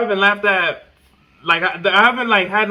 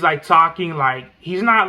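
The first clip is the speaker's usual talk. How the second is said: usual speaking voice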